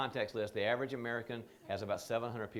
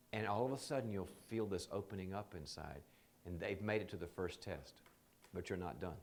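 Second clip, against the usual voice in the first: ordinary talk, nothing marked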